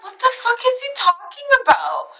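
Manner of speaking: high-pitched baffled reaction; incredulous. Retold or imitated. Woman recalling her reaction to the shapeshifter, incredulous. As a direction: retell it in high-pitched, bewildered disbelief.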